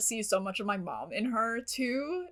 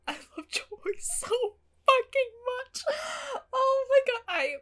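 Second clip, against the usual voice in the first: tearful; 13 semitones higher than usual. Real, not acted. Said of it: "I'm gonna cry again".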